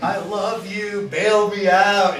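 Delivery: high, loud and slow desperate begging; pleading with ex-wife. Retold or imitated. Arrested man calls to his ex-wife, high loud slow plea. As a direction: stretch it out, begging her to bail him out. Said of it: "I'm like".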